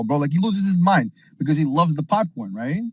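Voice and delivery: ordinary delivery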